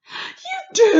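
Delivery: high, loud eager cry; delighted cry. Acted. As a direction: burst out with joy. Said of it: "cried the B F G".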